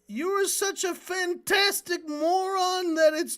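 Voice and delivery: put-on twelve-year-old Norm answering his mother in a high, slow voice; young Norm. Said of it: "he says".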